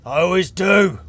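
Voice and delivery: high, very loud retort; shouting back. Acted. Character below answers Irilys up on deck, shouting back. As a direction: holler the comeback up at them.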